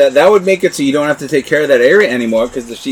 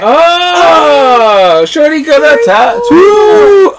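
excited shout; 12 semitones higher, 5 dB louder, much slower than usual. Real, not acted. Excited reveal of tattoo.

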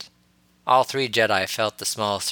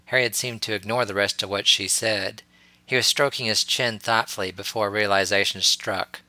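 ordinary delivery, nothing marked

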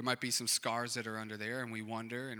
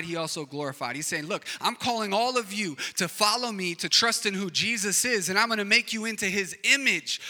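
higher, louder fervent preaching; preaching at full force; 6 semitones higher, 6 dB louder than usual. Acted. Pastor voicing God calling people to follow, in loud fervent preaching. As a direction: call it out like God inviting everyone.

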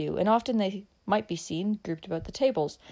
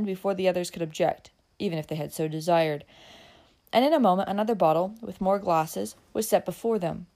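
ordinary delivery

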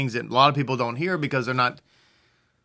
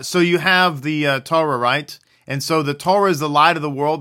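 ordinary delivery

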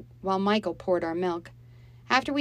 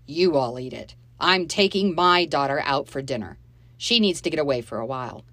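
curt anger; 2 semitones lower, 7 dB louder than usual. Acted. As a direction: brush them off coldly. Dave storming off angrily.

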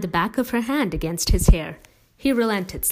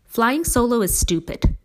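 mild grumble, higher and louder; grumbling; 7 semitones higher, 5 dB louder than usual. A narrator's reading. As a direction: complain with mild indignation. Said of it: "Dad grumbled".

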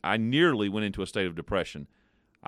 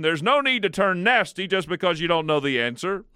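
loud, higher huffy shout; indignant lion shouting; 9 semitones higher, 5 dB louder than usual. Acted. Battered lion yelling at departing elephant, loud. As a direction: bluster like a beaten lion saving face. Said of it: "shouted the lion".

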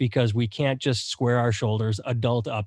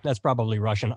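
usual speaking voice

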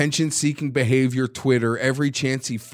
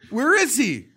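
higher, giggling through a jeering call; laughing taunt; 9 semitones higher, 4 dB louder than usual. Real, not acted. Fan mocking the hiding player, laughing taunt. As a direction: jeer at him through laughter.

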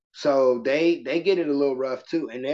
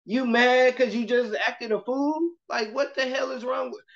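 high-pitched, incredulous questioning; disbelieving teacher, voice pitched up; exasperated scolding; 10 semitones higher than usual. Retold or imitated. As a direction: demand an explanation from a misbehaving kid. Teacher scolding a child, acted.

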